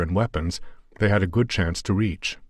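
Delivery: ordinary delivery, nothing marked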